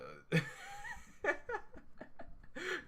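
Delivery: long high squeaky laugh. Real, not acted. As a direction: crack up at the drawing. Laughs at giant sword.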